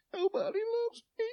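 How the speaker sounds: a put-on crybaby wail of self-pity; mock crying. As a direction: whine like a pitiful crybaby.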